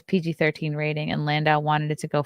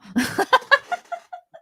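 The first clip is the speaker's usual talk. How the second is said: high laugh. Real, not acted. Laughs at sports bra.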